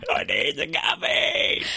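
drawn-out demonic shout; hammed-up demon voice, stretched words; possessed. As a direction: roar it like a possessed addict. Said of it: "you just become possessed".

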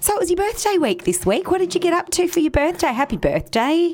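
high, fast presenter enthusiasm; mock-thrilled, high and quick; hammed-up excitement. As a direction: restart with exaggerated host pep. Second restart of the birthday topic.

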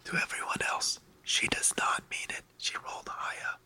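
very soft, confidential whisper; whispered aside. Real, not acted. Game master tells everyone else she does not mean it, whispered aside. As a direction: let the others in on a secret, whispering.

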